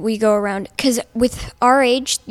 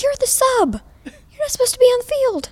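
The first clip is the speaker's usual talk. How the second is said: mock coach's holler, very high; yelling coach; 4 dB louder than usual. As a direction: play the exasperated coach calling out, voice pitched way up. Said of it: "the coach was yelling us".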